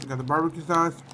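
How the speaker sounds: ordinary delivery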